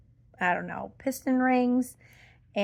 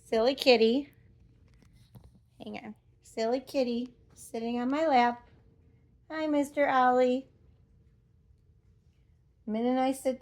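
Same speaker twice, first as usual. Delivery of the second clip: soft, affectionate pet talk; gentle, playful fondness; cooing to a cat; 5 dB quieter than usual. Real, not acted. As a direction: talk sweetly to the kitty on your lap. Talking fondly to cat on lap.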